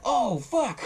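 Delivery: high, drawn-out cry of dismay; mock horror. Retold reaction to floating head.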